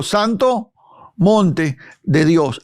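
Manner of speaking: slow, deliberate, word by word; slow recital. Shown as a demonstration. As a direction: say it slowly for the class to repeat. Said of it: "diga conmigo".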